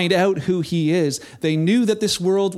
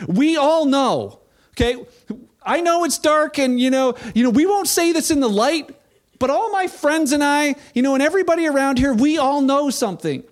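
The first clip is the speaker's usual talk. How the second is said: eager Nicodemus; 7 semitones higher than usual. Acted. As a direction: lean in as a nervous Pharisee confiding at night. Nicodemus voiced coming to Jesus.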